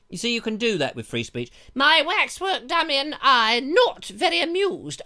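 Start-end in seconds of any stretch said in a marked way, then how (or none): 1.7-5.0 put-on high, loud regal old lady voice; imperious mock royal decree; hammed-up queen; Queen Victoria. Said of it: "I'm going into Queen Victoria".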